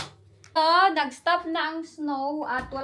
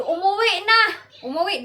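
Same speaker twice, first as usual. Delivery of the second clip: surprised exclaim; 6 semitones higher, 5 dB louder than usual. Real, not acted. Surprised husband came home.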